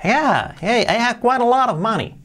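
high, breezy native speaker; breezy American "I have quite a lot of money"; casual native boast. Acted.

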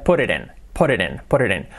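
fast casual "put it in" with flapped t as r; quick linked "put it in". Shown as a demonstration. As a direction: rattle off "put it in" linked together. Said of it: "dicho rápido y dicho coloquialmente".

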